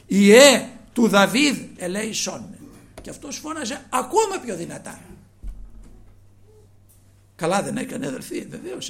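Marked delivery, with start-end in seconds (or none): 0.1-2.6 slow, loud drawn-out plea; crying out louder. A narrator's reading. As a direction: stretch the cry for mercy out. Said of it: "πολλό μάλλον έκραζε". (αργή δυνατή κραυγή για έλεος)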